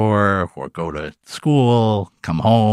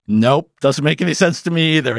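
higher, offhand "nope"; teacher's admission; 7 semitones higher, 3 dB louder than usual. Retold or imitated. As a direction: shrug it off casually. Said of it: "And he's like".

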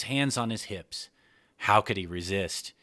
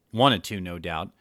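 ordinary delivery, nothing marked

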